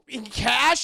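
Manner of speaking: high loud disbelief; shocked squeak. Acted. Anna shocked at the ransom demand, high and loud.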